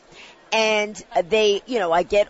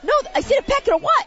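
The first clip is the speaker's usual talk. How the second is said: high squeaky disbelief, a little louder; shocked disbelief; 4 dB louder, faster than usual. Retold or imitated. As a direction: yelp it like you can't believe it. Incredulous retold reply, +14 semitones.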